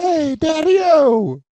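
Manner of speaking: high, stretched-out hepcat holler; mock hepcat call. Co-host mocks the buddies' slang, in a high drawn-out hepcat call. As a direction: sing it out like a cartoon beatnik. Acting out hepcat catchphrase mockingly.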